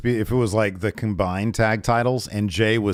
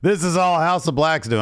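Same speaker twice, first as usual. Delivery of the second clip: mocking tone; 8 semitones higher, 4 dB louder than usual. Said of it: "they're saying".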